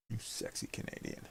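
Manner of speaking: breathy teasing whisper. Real, not acted. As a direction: whisper it like a secret compliment. Greeting a chat member teasingly.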